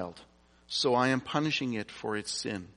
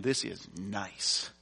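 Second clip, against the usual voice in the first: slow, slightly lowered, lightly voiced contented pig; 3 semitones lower, 4 dB quieter, slower than usual. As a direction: settle lazily into it, like a pig in slop. Voicing pig content in slop.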